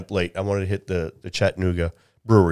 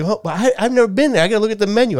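higher, a touch panicky customer; flustered customer; 13 semitones higher, 4 dB louder, faster than usual. Retold or imitated. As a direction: plead for time, a little rattled. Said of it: "And like".

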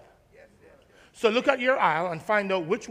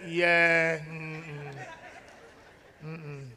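disapproving relative; 8 semitones lower, 9 dB quieter than usual. Retold or imitated. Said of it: "you go home and say".

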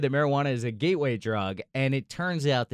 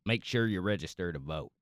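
lightly put-on Southern accent, low and soft; 6 semitones lower, 7 dB quieter than usual. Shown as a demonstration. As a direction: try an easygoing Southern accent. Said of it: "I can do a Southern accent".

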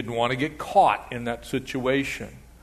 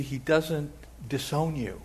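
usual speaking voice

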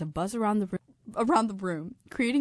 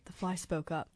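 ordinary delivery

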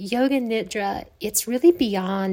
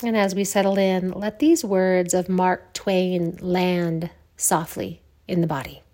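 guided meditation. Acted. Yoga teacher settling listeners to lie down, guided meditation. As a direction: ease them gently into rest, with long pauses.

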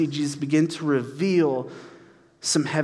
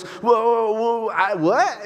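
high, flustered "whoa, whoa"; higher, baffled stammer; startled Jasmine; 6 semitones higher, slower than usual. Retold or imitated. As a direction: balk like someone pushed toward a window.

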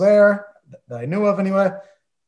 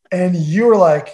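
ordinary delivery

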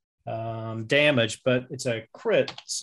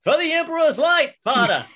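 high, loud shout from a charging fighter; full-voiced, high-pitched war cry; battle-cry scream; 14 semitones higher, 7 dB louder than usual. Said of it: "you hear him scream out".

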